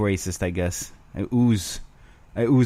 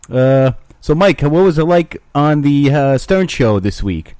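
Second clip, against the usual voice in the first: raised, slowed host voice; mock radio host, pitched up; interviewer voice; 6 semitones higher, 7 dB louder, slower than usual. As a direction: play the talk-show host asking Mike. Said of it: "maybe I could do a Mike impression".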